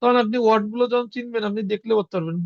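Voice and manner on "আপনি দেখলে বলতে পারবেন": normal talking voice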